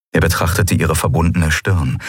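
ordinary delivery

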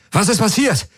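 very high, alarmed cry; urgent call. A narrator's reading. Galen calling into his comm amid sirens, alarmed call. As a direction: demand news in a high, urgent voice. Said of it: "rief er in sein Comm".

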